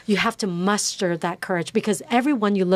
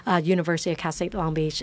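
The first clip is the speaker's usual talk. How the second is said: usual speaking voice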